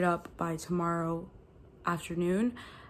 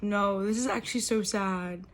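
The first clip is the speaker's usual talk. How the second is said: normal talking voice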